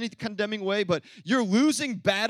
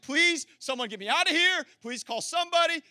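mock desperate plea; 8 semitones higher than usual. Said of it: "I'd be writing y'all letters like".

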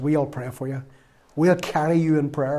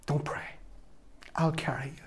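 a real choked whisper, barely voiced; emotional whisper; 11 dB quieter than usual. Real, not acted. Preacher, moved to tears by his friend's support, whispers. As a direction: let the words fall to a breath as the tears come.

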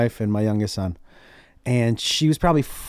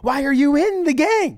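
high-pitched retold bafflement; acted incredulous parent; exasperated disbelief; 9 semitones higher than usual. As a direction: plead for sense from a child, pitched up. Exasperated parent questioning son.

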